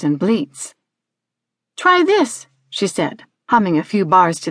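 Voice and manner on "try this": high-pitched eager little instruction; girl instructing. Acted. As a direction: pitch it up like a girl leading her sheep. Girl instructing her sheep.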